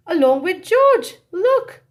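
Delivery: high, loud excited call; excited shout. Acted. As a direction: call out loud as the truck leaves.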